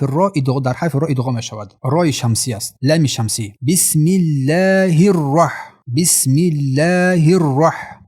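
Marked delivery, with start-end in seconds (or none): 3.7-8.0 slow 'الرح' with thick doubled r; heavy ra. Shown as a demonstration. Teacher models heavy ra, slowly chanted. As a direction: recite slowly, making the r of 'الرح' full and heavy.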